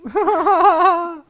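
sudden high burst of laughter; loud laugh. Real, not acted.

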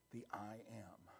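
breathy hush on the name; whispered. Acted. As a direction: drop to a whisper for weight. Hushed reveal of God's name.

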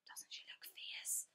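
slow breathless whisper. Acted. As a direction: whisper it behind her hand. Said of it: "whispered Melanie".

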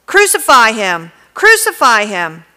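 loud repeated shout; crowd shouting. A narrator's reading. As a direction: yell it twice at the governor. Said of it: "they shouted".

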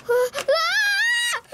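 sudden high scream. Pretend. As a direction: shriek in fright. Ghost toy jumps out.

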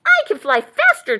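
high, loud bird chirp; chirping cardinal. A narrator's reading. Cardinal outdoes buck in story, chirping high. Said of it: "chirped the cardinal".

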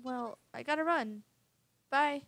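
loud, rushed goodbye; mother calling goodbye while rushing out; hurried goodbye. Acted. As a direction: call it out while leaving. Mother hurrying out the door.

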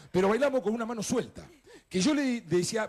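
usual speaking voice

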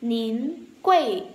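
normal talking voice

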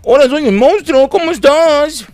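high, loud, slow silly monster voice; imitating monster character. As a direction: mimic the monster's greeting, stretched and high. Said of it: "pero habla así".

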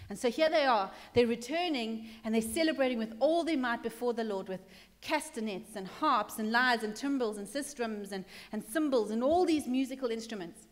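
ordinary delivery

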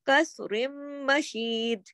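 "कसरिम मशीद" slowly, with a long joined m; idgham into meem. Shown as a demonstration. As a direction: hold the hummed m across "कसरिम मशीद". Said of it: "इसको हमने मीम के साथ".

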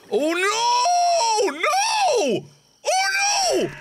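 high crying shout of grief; anguished wail. Real, not acted. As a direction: wail it out in despair. Accidentally killed the dog.

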